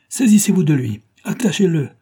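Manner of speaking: high, loud, angry command; acted villain's fury; furious order. A narrator's reading. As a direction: order the men to seize him. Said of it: "ordonna Thompson furieux".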